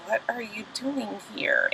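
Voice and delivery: hushed and awkward; uncomfortable. Retold or imitated. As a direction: ask it almost under the breath, uneasy. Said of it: "Mimi is all uncomfortable".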